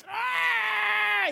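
high, sustained "aah" wail; the preacher mimics a child screaming before being touched, high mock wail; child's mock scream. Imitated high-pitched child's scream.

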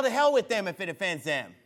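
normal talking voice

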